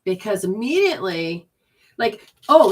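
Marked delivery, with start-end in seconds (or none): none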